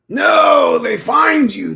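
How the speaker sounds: high, loud drawn-out cry; wailing mock panic; mock alarm. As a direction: shout a hammed-up warning. Hammed-up warning about succubi.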